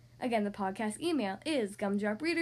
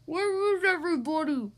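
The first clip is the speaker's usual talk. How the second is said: muffled, louder, lost skeleton; 4 semitones higher, 5 dB louder than usual. Acted. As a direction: call out from under wrappings. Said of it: "he asked in a muffled voice".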